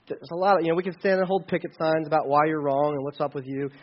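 ordinary talk

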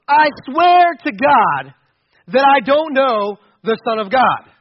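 loud, slow, forceful swearing; emphatic oath. Acted.

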